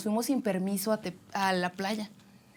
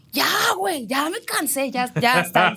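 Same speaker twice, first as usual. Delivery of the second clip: loud, higher-pitched desperate whine; raised, wailing voice of someone giving up; exhausted pleading; 4 semitones higher, 5 dB louder than usual. Retold or imitated. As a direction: imitate a worn-out swimmer crying out. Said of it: "empieza esa voz así, esa voz de".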